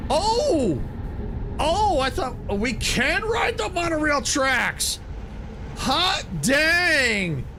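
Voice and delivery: high, excited exclaiming; thrilled discovery. Real, not acted. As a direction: crow with delight, stretching the big words. Discovers he can ride monorail tracks.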